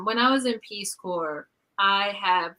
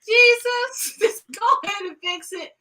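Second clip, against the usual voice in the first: exasperated; 11 semitones higher than usual. Retold or imitated.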